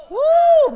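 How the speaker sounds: high, loud joyful whoop; sudden whoop of praise; excited whoop. Real, not acted. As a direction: let out a happy holler.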